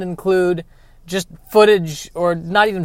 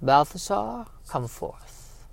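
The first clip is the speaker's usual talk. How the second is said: sinister royal whisper; 9 dB quieter, slower than usual. Acted. Villain summons his servant.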